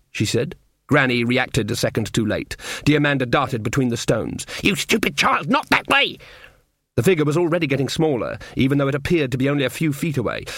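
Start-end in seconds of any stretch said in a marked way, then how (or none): 4.6-6.2 shouting. A narrator's reading. Granny shouts as Diamanda runs.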